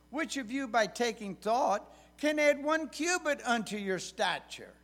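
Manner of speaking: lifted King James reading voice; scripture reading. Acted. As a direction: read the verses out with weight.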